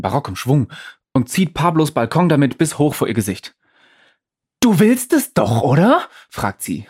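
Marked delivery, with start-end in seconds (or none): 4.6-6.1 high, loud, slow tempting devil; devilish visitor voice. Acted. Undine speaking in the devilish visitor's voice, high and loud. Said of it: "mit der Stimme des teuflischen Besuchers".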